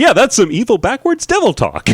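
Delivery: chirpy TV chef delivery; cooking show host. Retold or imitated. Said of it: "a real pleasant, "I'm on a cooking show" voice".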